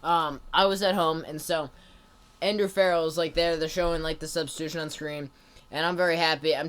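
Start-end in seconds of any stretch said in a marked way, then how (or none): none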